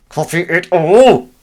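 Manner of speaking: high, loud, drawn-out silly voice; exaggerated, high, stretched-out order; put-on command. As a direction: call it out slowly in a put-on voice. Commanding the software after failed tries.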